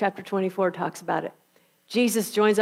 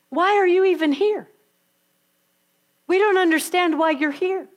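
puzzled angels; 8 semitones higher, 3 dB louder than usual. Acted.

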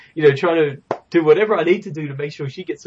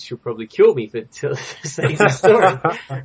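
stifled giggles; 5 dB louder than usual. Real, not acted. Embarrassing story, laughing while telling.

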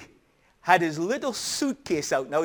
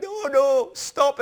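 higher, urgent mock pleading; retold alarm; 8 semitones higher, 4 dB louder, slower than usual. Father trying to stop his son packing, retold alarm. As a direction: plead as a flustered parent, acted out.

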